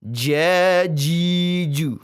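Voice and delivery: "Jajiju" drawn out slowly with a light hiss on the j; slightly hissing. Shown as a demonstration. Teacher demonstrates the correct jim, slow with a slight hiss. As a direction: stretch "Jajiju" with a touch of hiss. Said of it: "Itu suara sedikit mendesis".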